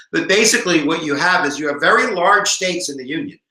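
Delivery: ordinary talk, nothing marked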